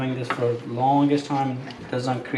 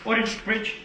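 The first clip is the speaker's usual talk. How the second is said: high, crisp command call; dog command; 8 semitones higher than usual. Real, not acted. Trainer calling Odin to come, high command.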